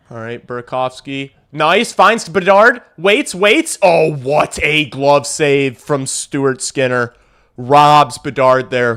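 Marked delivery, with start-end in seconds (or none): 1.5-9.0 excited play call. Real, not acted. Bedard chance robbed.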